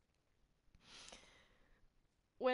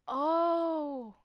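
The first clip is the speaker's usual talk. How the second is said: sudden realization; 7 semitones higher, 10 dB louder than usual. Acted.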